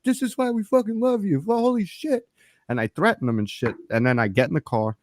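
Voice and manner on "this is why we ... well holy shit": cheering. Retold or imitated. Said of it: "staff ... cheering".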